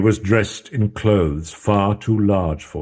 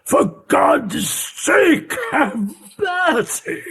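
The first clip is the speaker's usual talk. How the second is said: a very high, stretched, slow shriek of begging; pleading Hyde; 3 dB louder, slower than usual. Acted. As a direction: cry out for mercy in terror. Hyde pleads from behind door.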